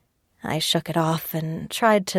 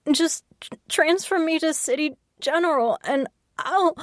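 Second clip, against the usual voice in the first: high, tearful and breaking; crying; 10 semitones higher than usual. Acted. Patient begging to be moved, crying. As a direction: plead through rising tears. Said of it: "it only made me cry more".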